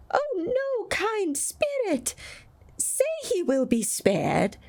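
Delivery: put-on very high, slow begging old man; desperate plea. A narrator's reading. Scrooge begging the spirit, very high and slow. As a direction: plead with everything he has.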